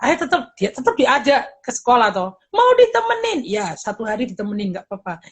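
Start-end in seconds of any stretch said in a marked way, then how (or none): 2.5-3.5 put-on high hopeful child's question; a small kid begging mom to stay; child asking. 3.5-5.2 quiet, low gentle reply; soft parent answer. Retold or imitated. Parent softly answering child.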